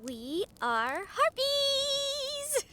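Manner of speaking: high, very slow, drawn-out reveal; grand cheerful declaration; proud announcement. Acted. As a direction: savour the big reveal. Briar proudly announces what they are.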